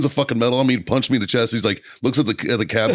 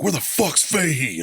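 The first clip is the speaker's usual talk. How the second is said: barking colonel; 7 semitones higher, slower than usual. Retold or imitated. Colonel storms in demanding.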